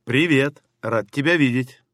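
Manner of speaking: normal talking voice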